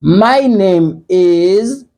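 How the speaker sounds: slow, carefully spaced English model; slow teacher's model sentence; slow clear demo. Shown as a demonstration. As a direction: say it slowly for learners. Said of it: "sa pa pwononse kòm is".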